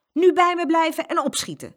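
high, loud scolding order; stern. A narrator's reading. Mama to the twins outside, stern command. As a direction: order the children along strictly. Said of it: "zei ze streng".

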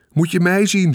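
put-on high, excited boyish yell; boy's showy shout. A narrator's reading. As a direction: shout for attention like a show-off kid. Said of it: "schreeuwde Michiel". (opschepperig jongetje dat schreeuwt)

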